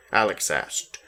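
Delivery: ordinary delivery, nothing marked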